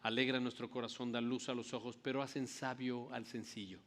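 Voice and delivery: earnest, soft-spoken pastoral prayer; quiet prayer before the sermon. Acted. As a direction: pray over the congregation, humbly. Pastor leads opening prayer.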